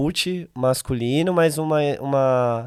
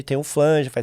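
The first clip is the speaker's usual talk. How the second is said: usual speaking voice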